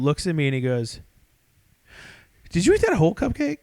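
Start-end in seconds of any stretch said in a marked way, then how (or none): 2.5-3.6 high-pitched, quick disbelief; acted-out incredulous question, high and fast; mock alarmed question. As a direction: imitate a friend's startled double-check. Retold friend's alarmed question.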